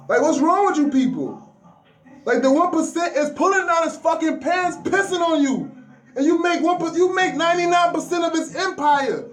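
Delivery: indignant rant at the ninety-nine percent; fired-up rant. Real, not acted. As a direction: rail at them, voice rising. Ranting at listeners.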